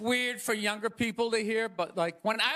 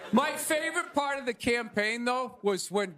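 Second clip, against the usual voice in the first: ordinary talk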